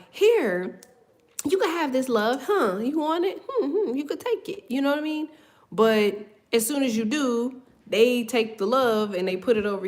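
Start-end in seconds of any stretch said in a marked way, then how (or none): 0.1-4.7 tempting offer. Retold or imitated. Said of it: "Ace of Cups to me is like".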